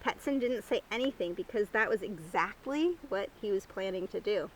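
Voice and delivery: plain reading voice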